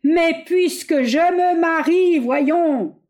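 slow, high, loud hammering; shouting each syllable. A narrator's reading. Bois d'Anguin spells it out for the General, shouting slowly. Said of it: "criant toujours et articulant chaque syllabe".

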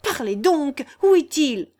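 high, loud, angry demand; enraged cry. A narrator's reading. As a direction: demand an answer in a burst of rage. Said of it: "s'écria Guillaume en frémissant de rage".

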